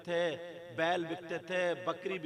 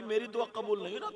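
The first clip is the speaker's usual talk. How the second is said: usual speaking voice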